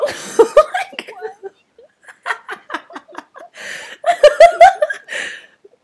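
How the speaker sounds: long, high laugh; burst of laughter. Real, not acted. Hearing a friend's absurd one-upping story, high real laugh. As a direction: let out a high helpless laugh.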